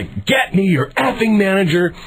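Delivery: slow, high-pitched angry demand; retold irate order; screaming demand. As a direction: demand it slowly, word by word, fuming. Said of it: "I start screaming".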